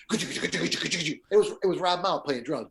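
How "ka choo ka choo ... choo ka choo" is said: rapid mouthed drum noises; imitated drum solo. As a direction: chug out a drum pattern with your mouth. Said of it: "All I hear is".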